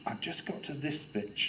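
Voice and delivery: softly awed by a book; soft, reverent murmur; teacher's hushed wonder. Retold or imitated. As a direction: imitate a teacher sharing a treasured page. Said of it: "I loved the way she opened a book".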